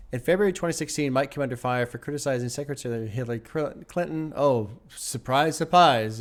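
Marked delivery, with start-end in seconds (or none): 4.4-6.2 slow, drawn-out sarcasm; sarcastic mock surprise. Real, not acted. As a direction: draw it out as if utterly unsurprised. Sarcastic reaction to criticism of Clinton.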